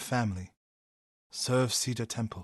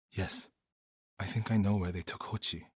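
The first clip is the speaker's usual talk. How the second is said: ordinary delivery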